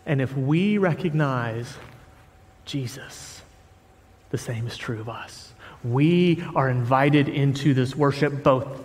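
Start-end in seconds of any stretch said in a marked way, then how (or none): none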